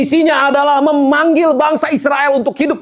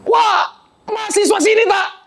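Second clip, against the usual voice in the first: astonished driver; 11 semitones higher than usual. Retold or imitated. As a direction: let it burst out high in disbelief. Surprised driver questioning. (terkejut dan bertanya dengan nada tinggi)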